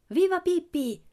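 high, loud crowd cheer; cheering shout. A narrator's reading. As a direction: cry out in jubilant acclaim. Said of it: "gridò la gente". (grido festoso della folla)

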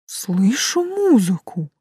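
slow, drawn-out wonder; amazed. A narrator's reading. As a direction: marvel at it, stretching the words. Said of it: "изумлённо воскликнул Лин". (изумлённо, протяжно)

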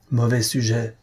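usual speaking voice

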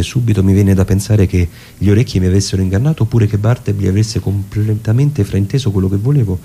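normal talking voice

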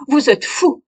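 slow, loud, high outburst; old man's furious slow cry. Acted. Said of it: "s'écria-t-il".

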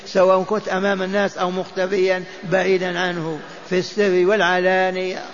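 normal talking voice

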